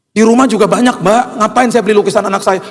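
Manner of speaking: high, exasperated objection; indignant protest. Retold or imitated. Father arguing with the organizer, high exasperated protest. Protests having to buy painting.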